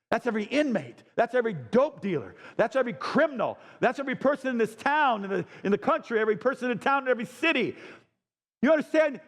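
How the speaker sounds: preacher driving the point home to the congregation; preaching. Real, not acted. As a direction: build the list up like a preacher rising. Preacher builds to a climax.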